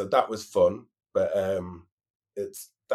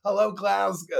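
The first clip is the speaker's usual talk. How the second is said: high, mock stage greeting; American queen on mic; 10 semitones higher than usual. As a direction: greet the crowd like a visiting American drag queen. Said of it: "American queens come over".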